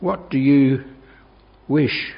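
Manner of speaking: ordinary talk, nothing marked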